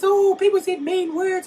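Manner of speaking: high, loud, mock-hurt whining; mocking whine. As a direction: mimic a crybaby.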